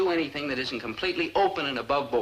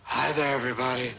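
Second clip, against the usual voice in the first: lower, breathy and seductive; low sexy voice; 5 semitones lower, faster than usual. Shown as a demonstration. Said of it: "Lower and sexier and more".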